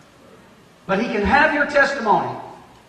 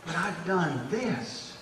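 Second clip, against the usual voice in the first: timid self-doubt; 4 semitones lower, 11 dB quieter, slower than usual. Acted. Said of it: "first thing that runs to your mind is".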